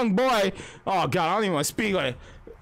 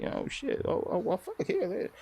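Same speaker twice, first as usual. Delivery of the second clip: hushed caught out; 7 dB quieter than usual. Retold or imitated. Girl caught, hushed reaction.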